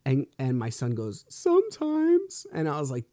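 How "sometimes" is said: put-on very high, drawn-out child voice; imitating young son. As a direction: mimic a little boy, slow and high. Voicing his young son's answer.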